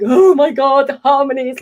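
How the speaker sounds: mock starstruck fan burst; fangirl gushing. Fan meeting her harmony idol, gushing. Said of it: "met him and was like".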